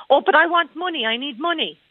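high-pitched imitation of a child's demand; little boy pleading. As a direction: jump up high like an insistent small kid. Retold little boy demanding money.

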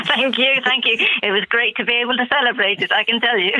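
laughing. Real, not acted. Caller laughing, thrilled on air.

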